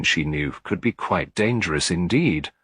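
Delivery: plain reading voice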